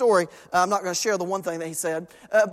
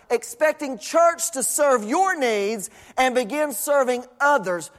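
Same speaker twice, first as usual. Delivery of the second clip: exhorting preacher; 4 semitones higher than usual. Acted. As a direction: hammer the command home word by word. Reads verse, then exhorts.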